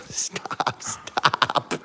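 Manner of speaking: breathy unvoiced stop through laughter; laughing whisper. Real, not acted. Owner laughing as dogs misbehave, whispered. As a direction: beg them to stop, half laughing.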